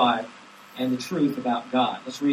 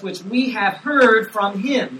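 slow and louder on heard; emphatic reading; 5 semitones higher, 10 dB louder, much slower than usual. Shown as a demonstration. Pastor stressing the apostles' witness, slow and loud. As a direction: draw out which we have heard. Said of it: "him being Jesus".

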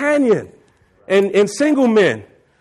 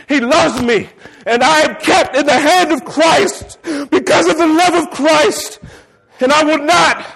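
defiant shout; 4 semitones higher than usual. Retold or imitated. Preacher retelling his answer to Satan, shouting defiantly. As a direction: shout it back at the devil, full conviction. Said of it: "And I said".

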